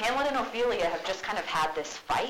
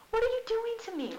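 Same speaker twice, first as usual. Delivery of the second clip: much higher, fast and distressed; upset Ophelia; faster than usual. Retold or imitated. Teacher voicing Ophelia hurt by Hamlet's teasing. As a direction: plead with hurt disbelief.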